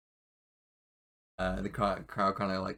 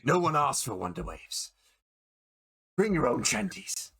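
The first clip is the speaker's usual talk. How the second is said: lightly voiced grumbling onlooker; heckle, rising higher by the end; crowd member; 4 semitones higher than usual. People in the crowd about the shot player, lightly voiced grumble. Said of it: "you see people in the crowd be like".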